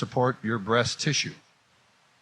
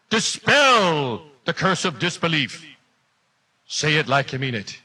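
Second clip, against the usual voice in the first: mock revival preacher; 5 semitones higher than usual. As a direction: rouse the congregation like a revival preacher. Preacher act for class.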